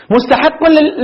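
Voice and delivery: ordinary talk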